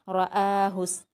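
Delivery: "रआहुस" with short ra and a separately started long aa; separate hamza. Shown as a demonstration. Teacher explaining separate harakat, distinct hamza in "रआहुस". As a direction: give the ra of "रआहुस" its own short vowel, then stretch aa. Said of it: "रा पर अलग हरकत है".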